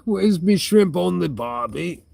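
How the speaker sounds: put-on high-pitched jokey Australian accent; mock Australian accent. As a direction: put on a playful Aussie voice. Jokey Aussie stock phrase after a laugh.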